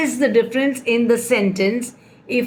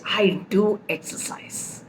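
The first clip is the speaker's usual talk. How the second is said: quiet example with weight on "do"; softly insist, leaning on "do"; "do" emphasized, said softly; stress on do; 2 semitones lower, 7 dB quieter than usual. Shown as a demonstration. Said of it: "emphasizing on the main verb".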